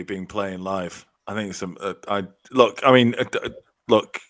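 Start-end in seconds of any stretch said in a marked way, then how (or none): none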